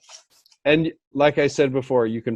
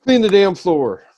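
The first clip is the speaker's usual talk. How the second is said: barked boss order, raised; bossy order; 7 semitones higher, 4 dB louder than usual. Retold or imitated. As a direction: bark it like a boss. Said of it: "like a boss coming in and say".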